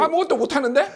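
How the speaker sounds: high mock-indignant retort; acted incredulous quarrel. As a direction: argue back in pretend disbelief. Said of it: "이렇게 둘이 싸울 것이라는 거예요".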